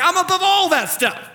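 high, loud protest as God; emphatic dismissal. Acted. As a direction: wave it all away, loudly and high. God dismissing politics, acted.

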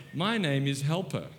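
ordinary talk

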